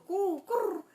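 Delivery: high cooing pigeon; mock pigeon call. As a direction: coo like a pigeon. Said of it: "I presume the pigeons go".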